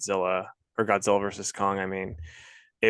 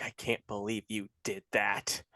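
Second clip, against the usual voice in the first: hushed scolding; 2 semitones lower, 10 dB quieter than usual. Retold or imitated. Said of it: "Bree got so mad at me".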